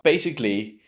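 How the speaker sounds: normal talking voice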